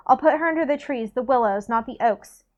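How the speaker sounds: ordinary delivery, nothing marked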